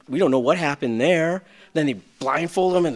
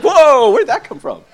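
surprised; 8 semitones higher, 9 dB louder than usual. Retold or imitated. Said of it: "I'm like".

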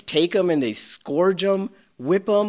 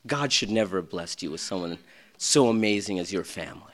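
inner critic; 6 semitones lower, 4 dB quieter than usual. Retold or imitated. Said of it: "I looked in the mirror, and I said".

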